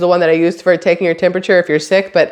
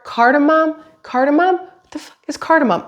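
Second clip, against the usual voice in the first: baffled; 7 semitones higher than usual. Real, not acted. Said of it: "struggling with the word".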